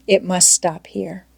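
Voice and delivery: ordinary delivery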